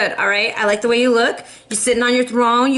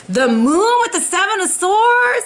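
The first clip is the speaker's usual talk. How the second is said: excited shout; 7 semitones higher than usual. Real, not acted. Tarot reader to Libra viewers, shouting the card excitedly. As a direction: call it out with a thrill.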